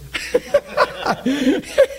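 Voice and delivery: high, real laughing burst; delighted laughter. Real, not acted. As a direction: break up laughing at the memory. Laughs at friend's reaction.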